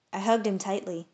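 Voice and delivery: ordinary delivery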